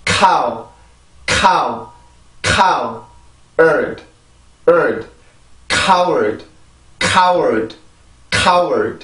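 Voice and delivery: deliberate drill, scraped k in 카우 and 카워드; pronunciation drill. Shown as a demonstration. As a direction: build "coward" from a puffed 카우 plus short 얼드. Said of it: "다시 한번 해볼게요".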